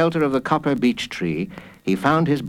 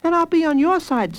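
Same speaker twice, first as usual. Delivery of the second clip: put-on high child voice; high-pitched kid brother; eager little boy. A narrator's reading. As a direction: offer help as a small boy. Small boy offers help.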